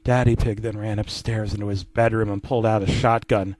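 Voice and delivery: ordinary talk, nothing marked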